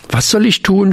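a high, frightened blurt; little frog cries out in fear to the elders; fearful outburst. A narrator's reading. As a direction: squeak it out in panic. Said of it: "stieß voller Angst".

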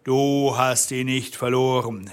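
usual speaking voice

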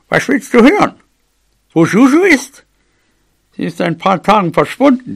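put-on granny, irritated; exasperated mouse granny. A narrator's reading. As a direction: answer him impatiently as the old mouse. Grandmother turns back, answers gruffly.

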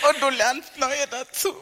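laughing. Real, not acted. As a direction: let the laugh carry the whole line. Lacht beim Einwurf. (lachend, mitten im eigenen Lachen gesprochen)

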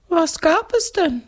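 very high, inquisitive spook; curious ghost. A narrator's reading. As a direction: ask it eagerly in a high ghost voice. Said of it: "fragt das Gespenst neugierig".

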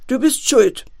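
loud blame thrown at the son; accusing call. A narrator's reading. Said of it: "rief Herr Clown seinen Sohn zu". (laut anklagend gerufen)

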